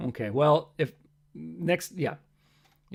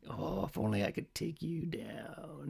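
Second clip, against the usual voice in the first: scheming cat; 4 semitones lower, 17 dB quieter than usual. Retold or imitated. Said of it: "he's like".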